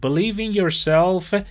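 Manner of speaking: slow and lifted, stretched for learners; slow model phrase. Shown as a demonstration. Teacher repeating the phrase for students, slow and clear. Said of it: "خودتو باور داشته باش".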